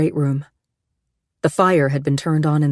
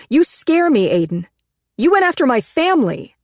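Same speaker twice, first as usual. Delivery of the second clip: high, raised frightened accusation; scared accusation; 10 semitones higher, 4 dB louder than usual. Acted. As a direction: tell him he scares her. Frightened wife accuses him.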